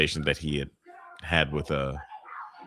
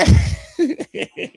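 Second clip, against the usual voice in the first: shrieking laugh; 9 dB louder than usual. Real, not acted. Laugh after shocked shout.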